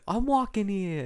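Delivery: high, mock New Yorker shout; New York accent. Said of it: "doing someone from New York".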